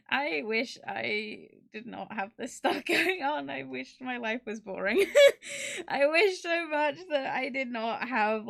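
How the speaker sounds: laughing through the words; half laughing, half crying. Real, not acted. Laughing about group drama.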